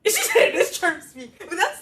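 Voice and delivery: slow, high laughing repeat; laughing echo. Real, not acted. Echoing her friend's tease, laughing slow and high. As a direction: laugh it back at her, drawn out.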